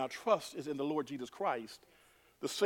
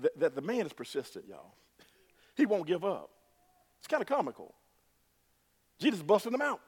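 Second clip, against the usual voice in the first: ordinary talk, nothing marked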